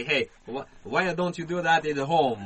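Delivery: put-on loud, slow Italian-accented scolding; imitated Italian accent. Passers-by confront the man, scolding loudly in Italian accent. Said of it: "people will turn around and say".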